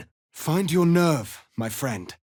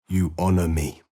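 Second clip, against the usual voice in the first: ordinary talk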